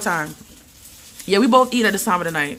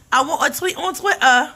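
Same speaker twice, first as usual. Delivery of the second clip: "tweet" and "Twitter" in a giggly, higher-pitched English accent, slower; attempted English accent; 5 semitones higher than usual. Shown as a demonstration. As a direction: laugh your way through a bad English accent on "tweet on Twitter". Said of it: "Like my English accent, I'm trying".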